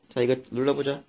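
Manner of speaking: usual speaking voice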